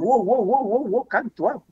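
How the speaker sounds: série de wow aigus et ondulants; protestation ahurie. Retold or imitated. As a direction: freiner quelqu'un qui s'emporte, voix haute. Tente de calmer le policier agressif. (holà haut perché, pour calmer)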